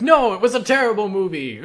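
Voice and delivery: high, loud mock retort; arguing opponent. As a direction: fire back in an acted argument. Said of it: "you're having an argument with someone".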